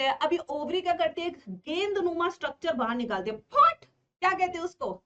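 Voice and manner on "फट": sudden high, loud "phat!"; sharp exclaimed pop, pitched high; popping sound. Retold or imitated. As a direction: burst it out like a pop. Imitating ovary popping out the egg.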